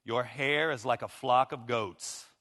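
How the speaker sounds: slow, tender reading of the Song; scripture reading. Acted. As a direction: savour the verses. Reads Song of Songs aloud.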